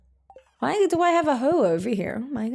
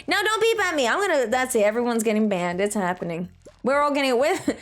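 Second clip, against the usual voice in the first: ordinary talk, nothing marked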